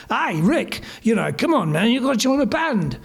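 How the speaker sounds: chummy mock English bloke. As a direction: coax in an imitated English accent. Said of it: "he's this kind of guy".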